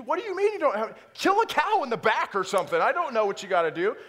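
hammed-up fury at the missing burrito; mock outrage. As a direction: plead in comic exasperation.

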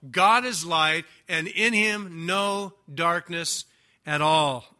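ordinary talk

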